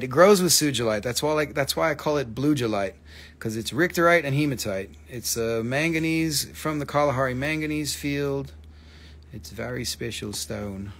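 usual speaking voice

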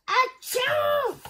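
loud high sneeze; big sneeze. Acted. Gerald sneezing in front of Piggy, loud sneeze. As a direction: Gerald lets out a huge achoo.

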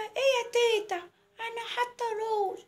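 put-on high child voice answering grandma; innocent little girl. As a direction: answer back as a small girl. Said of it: "قالت لها". (صوت طفلة بترد على تيتا)

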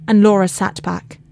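ordinary delivery, nothing marked

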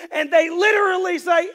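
very loud, high sermon storytelling; full-force preaching. Real, not acted. Preacher retells disciples in the storm to congregation, full-force preaching. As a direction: shout the story to the back pew.

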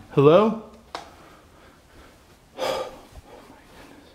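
usual speaking voice

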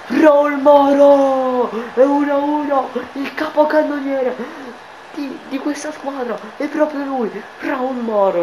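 drawn-out shouted goal call; breathless italian goal commentary. Real, not acted. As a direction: yell it high and long.

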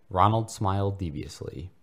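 usual speaking voice

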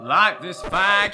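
slow, drawn-out mock plea. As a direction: drag it out slowly. Mock slow prayer line.

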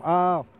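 loud high pained yelp; hammed-up cry of pain; mock scream. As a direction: yell ow like being hit. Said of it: "Beats me and scream".

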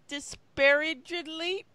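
ordinary talk, nothing marked

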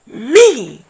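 high loud shout. A narrator's reading. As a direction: stand up to the wolf. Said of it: "shouted Mouse".

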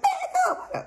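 very high squeak through a laugh; squeaky mock protest. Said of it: "I'm like".

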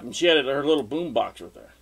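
usual speaking voice

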